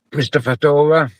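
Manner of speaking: usual speaking voice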